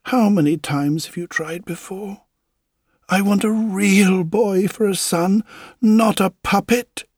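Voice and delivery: a grieving wife rising to an anguished shout; despairing wife. Acted. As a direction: cry out that she wants a real son. Wife's despairing outburst.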